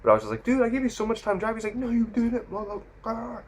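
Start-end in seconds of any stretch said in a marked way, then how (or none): 0.4-1.6 high-pitched exasperated protest. Retold or imitated. Retold argument with yelling driver. 1.8-3.4 high, strained mock yelling; mock angry driver. Driver yelling back in retold quarrel.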